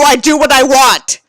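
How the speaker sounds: ordinary delivery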